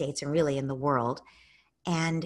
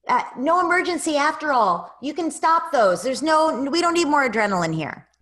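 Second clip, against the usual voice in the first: high, louder cheerful all-clear call; voicing the neurotransmitters; 9 semitones higher, 8 dB louder than usual. Neurotransmitters telling the body to calm down, high and bright. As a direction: play the brain calling off the alarm. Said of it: "your neurotransmitters are saying".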